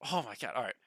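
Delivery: ordinary talk, nothing marked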